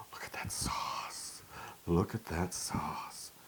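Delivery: awed hushed whisper. Real, not acted. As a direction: breathe it in a whisper.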